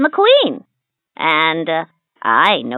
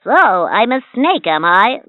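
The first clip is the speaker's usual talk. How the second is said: ordinary delivery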